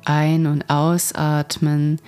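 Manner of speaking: calm and gentle, guide them inward; guided meditation. Acted. Leads a guided tree meditation. (ruhige Meditationsstimme)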